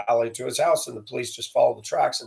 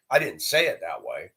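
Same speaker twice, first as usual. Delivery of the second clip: ordinary delivery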